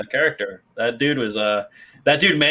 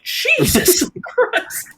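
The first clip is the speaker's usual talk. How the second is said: high squeaky laughing "Jesus Christ"; laughing exasperation; 5 dB louder, much slower than usual. Retold or imitated.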